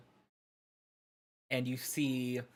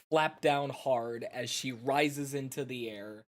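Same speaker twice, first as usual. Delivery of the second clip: ordinary talk, nothing marked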